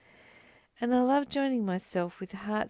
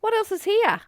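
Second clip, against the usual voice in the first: much higher and louder, a bright questioning voice; playful inner voice; 12 semitones higher, 7 dB louder than usual. Retold or imitated. Friend voicing the mind's question, high and playful. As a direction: ask it like a curious inner self. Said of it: "it's kind of saying".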